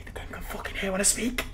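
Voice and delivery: hushed, rising squeak of alarm; panicked hushed voice. Real, not acted. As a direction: keep it tiny but alarmed. Hiding, game hears the microphone.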